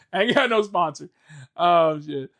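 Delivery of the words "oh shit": ordinary delivery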